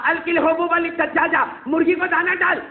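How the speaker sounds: enraged mother giving an order; angry mother. Acted. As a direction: spit out each word as a threat.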